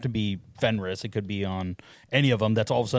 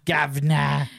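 higher, hammed-up British call; mock British accent; 4 semitones higher, 4 dB louder, slower than usual. Podcaster repeating the joke for friends, louder mock British accent. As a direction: exaggerate the British vowels playfully. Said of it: "they all have a British accent".

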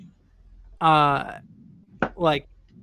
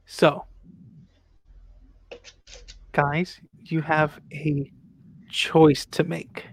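usual speaking voice

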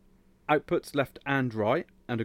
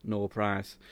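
ordinary talk, nothing marked

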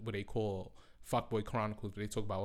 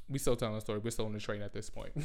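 usual speaking voice

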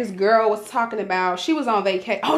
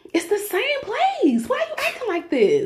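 exasperated; 12 semitones higher than usual. Real, not acted. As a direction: scold yourself in disbelief. Scolding herself over fast food.

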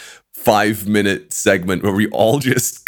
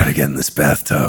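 Geralt; 9 semitones lower than usual. Retold or imitated. Friend retelling the acid trip as Geralt, deep gruff impression. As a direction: drop right down into a Geralt impression. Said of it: "like Geralt".

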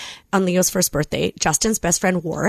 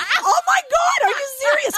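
high, slow, stretched shout of disbelief; shocked shriek. Retold or imitated. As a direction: shriek it in mock horror. Shocked reaction retold.

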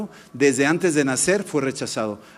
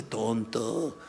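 little voice; 6 semitones lower, 8 dB quieter than usual. Retold or imitated. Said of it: "una vocecita me decía".

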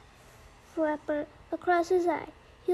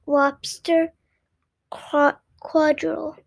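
loud, drawn-out title announcement; proud announcement of the title; title call; 10 dB louder, slower than usual. Shown as a demonstration. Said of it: "The Lobster Quadrille".

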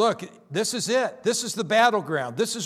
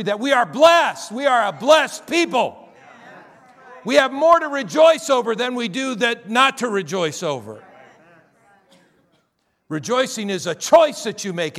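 full-force preaching; 3 semitones higher, 5 dB louder than usual. Acted. Pastor rallying the congregation, booming preaching. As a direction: lift the room and proclaim it.

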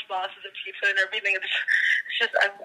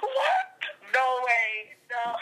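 usual speaking voice

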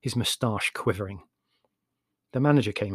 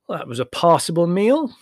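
higher, louder magnanimous verdict; generous; 6 semitones higher, 7 dB louder than usual. A narrator's reading. Larry sits back after the meal, speaking generously. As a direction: pronounce it as generous praise. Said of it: "he said generously".

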